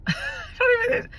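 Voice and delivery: breaking into tears, the voice cracking very high; overwhelmed and crying, words breaking off; real crying. Real, not acted. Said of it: "This is miraculous".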